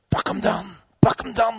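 urgent yell held inside; hoarsely urge, rising into a shout; inner shout. Acted. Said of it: "schreeuwde hij van binnen". (innerlijk geschreeuw, dringend)

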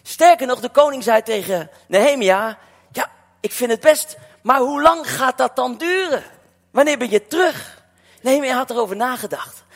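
preaching. Acted. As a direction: preach it out to the congregation.

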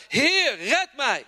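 sinking Peter crying out to Jesus; cry for help. Acted. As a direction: call out as if sinking. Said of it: "hij schreeuwde het uit".

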